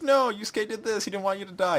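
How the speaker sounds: high-pitched mock plea; pleading Nobuaki. Imitating Nobuaki explaining to his kidnapper, high pleading voice. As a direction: plead it high, in a put-on protest.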